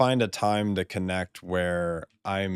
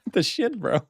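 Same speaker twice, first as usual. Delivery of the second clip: laughing disbelief; 12 semitones higher than usual. Real, not acted. Laughing in disbelief at talking mount.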